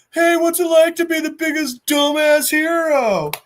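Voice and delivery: high-pitched mocking child voice; high, jeering kid; roasting kid. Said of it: "are just hardcore roasting him".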